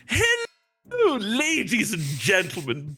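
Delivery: announcer showman. Acted. Player in character addressing the party kids, as showman. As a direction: present yourself like a stage show host. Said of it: "you say this in a very announcement fashion".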